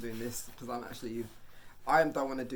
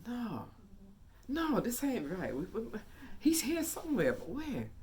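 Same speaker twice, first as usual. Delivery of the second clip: high, drawn-out puzzled protest; can't believe he's not found; puzzled disbelief; 11 semitones higher, slower than usual. Retold or imitated. Kids puzzled, searching in dark.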